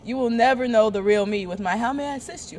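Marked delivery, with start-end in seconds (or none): 1.8-2.6 polite phone-operator voice, a bit higher; sweet, formal call-centre greeting; customer service voice. Retold or imitated. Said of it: "this is my customer service voice".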